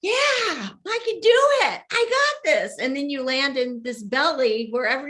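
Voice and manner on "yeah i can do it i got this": high, loud, drawn-out mock enthusiasm; hammed-up eager self-belief, way up in pitch; gung-ho confidence. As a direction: crow it like an overeager rookie. Acts out eager confident soul before birth.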